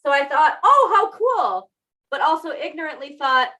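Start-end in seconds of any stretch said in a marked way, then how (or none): 0.6-1.7 excited. Retold or imitated. Voicing her own earlier reaction.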